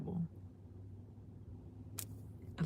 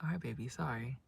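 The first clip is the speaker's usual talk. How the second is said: soft apology; 6 semitones lower, 8 dB quieter than usual. Real, not acted. Apologizing to son aside.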